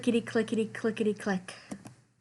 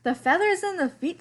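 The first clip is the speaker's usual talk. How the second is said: very high squeaky clown voice; 9 semitones higher than usual. A narrator's reading. Clowns raving about the sweater, squeaky high.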